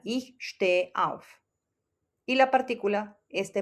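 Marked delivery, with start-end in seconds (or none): 0.0-1.3 slow, careful German sentences; slow model sentences; slow German examples. Shown as a demonstration. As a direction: read each example slowly for learners. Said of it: "la partícula va al final".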